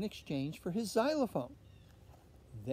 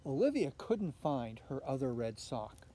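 usual speaking voice

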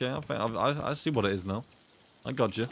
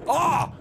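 sudden loud, high yelp; sharp high scream, cut short; startled yell; 5 dB louder than usual. Real, not acted. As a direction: cry out in shock. Fish attacks in game again.

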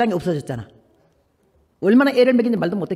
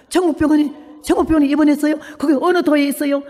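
amazed questioning; 6 semitones higher, 4 dB louder than usual. Retold or imitated. Nun questions the heavenly hospital.

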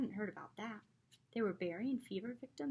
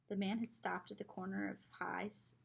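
usual speaking voice

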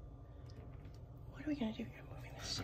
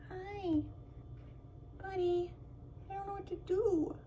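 cooing pet talk; 7 semitones higher, 5 dB quieter than usual. Real, not acted. Woman cooing high to a squirrel at her window, pet talk.

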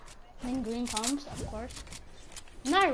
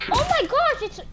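excited; 7 semitones higher, 5 dB louder than usual. Real, not acted. Spots Iron Man in game.

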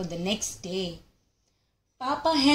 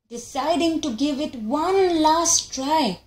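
usual speaking voice

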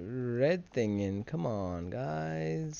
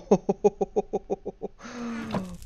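high, gleeful chuckle; delighted laugh; 8 semitones higher than usual. Real, not acted. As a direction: laugh with greedy glee. Laugh at the TNT.

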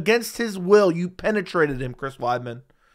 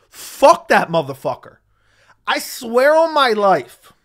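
loud, slow angry shout, much higher; real fury boiling over at the fighter; furious outburst; 6 semitones higher, 7 dB louder, slower than usual. Real, not acted. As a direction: bellow it with real rage. Furious rant about the fighter.

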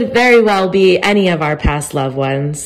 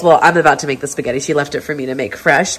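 ordinary talk, nothing marked

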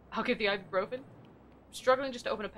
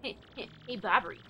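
ordinary talk, nothing marked

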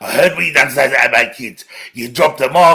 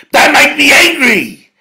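higher and louder, a ranting yell; furious shout; 9 semitones higher, 5 dB louder than usual. Real, not acted. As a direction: rage at them, every word hurled. Furious threat.

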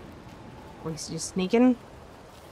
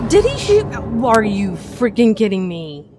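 outraged disbelief; 5 dB louder than usual. Real, not acted. Gamer shot at again by enemy, loud outraged disbelief. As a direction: blurt it out, fed up and shocked.

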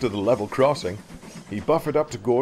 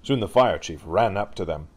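usual speaking voice

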